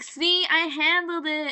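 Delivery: mock boasting. [name] showing off his win, loud mock boast. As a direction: crow it slowly and loudly.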